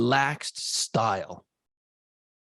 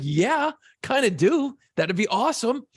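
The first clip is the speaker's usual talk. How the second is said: eager yes; 8 semitones higher than usual. Retold or imitated. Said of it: "I'm like".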